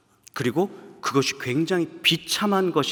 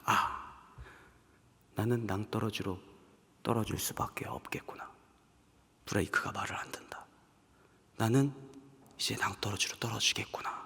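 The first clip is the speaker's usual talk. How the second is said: soft, whispered hopeless lament; despairing whisper; 4 semitones lower, 12 dB quieter than usual. Acted. A believer despairing before the cliff, soft whisper. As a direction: breathe it out as if giving up.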